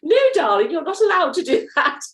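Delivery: high, prim English governess scolding; crisp disapproving governess voice; stern schoolmistress. Retold or imitated. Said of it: "a really stern schoolmistress saying".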